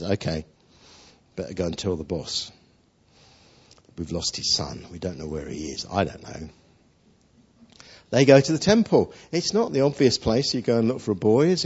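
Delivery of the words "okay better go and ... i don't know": sheepish Joseph. Retold or imitated. Joseph fearing telling the boss.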